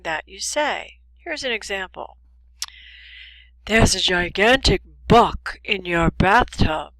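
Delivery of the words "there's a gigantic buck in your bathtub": "bug" devoiced at the end into "buck", said loud; voicing error. Warning someone about a bug in the bathtub, loud mispronounced "buck". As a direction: announce it loudly with a hard k in "buck".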